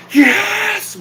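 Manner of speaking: put-on high-pitched drunken holler; drunken-style yell. Shown as a demonstration. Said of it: "yelling at the top of my lungs like a drunk".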